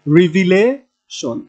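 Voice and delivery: Revelation slowly, লে held long before শন; drawn-out teaching revelation, weight on লে; stress on লে. Shown as a demonstration. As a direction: stretch and punch লে in revelation. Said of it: "লেটাতে হচ্ছে আমাদের stress হবে".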